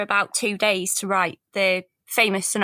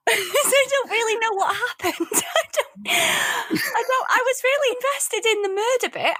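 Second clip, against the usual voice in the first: laughing; 10 semitones higher than usual. Real, not acted. Still laughing after her performance.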